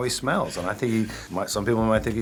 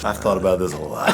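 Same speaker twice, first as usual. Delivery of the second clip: amused; 5 dB louder than usual. Real, not acted. Laughing confession.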